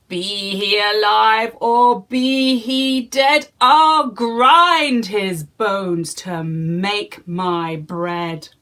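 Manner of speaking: loud, slow booming giant. Acted. Giant sniffing for Jack, booming and slow. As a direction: boom it like a hungry giant. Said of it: "he said".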